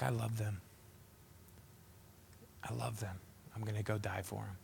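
soft tender murmur of devotion; hushed tender love. Retold or imitated. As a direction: breathe it out as a quiet vow of love. Jesus weeping for the lost.

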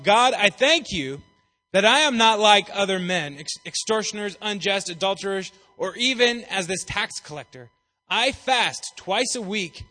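boastful Pharisee. Acted. Pharisee's boastful prayer read aloud.

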